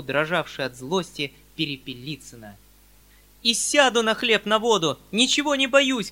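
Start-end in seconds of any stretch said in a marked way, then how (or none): 3.4-6.1 high, loud defiant cry; girl yelling defiance; defiant shout. Acted. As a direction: shout it back without fear. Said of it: "кричала Сашенька".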